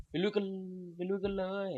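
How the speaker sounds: playful rallying call. Pretend. Player rallying viewers to head out in the game, playful high-pitched call.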